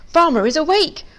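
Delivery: loud-ish, high cry of alarm; panicking. A narrator's reading. Pig seeing the farmhouse light, panicking. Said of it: "I starts to panic even more".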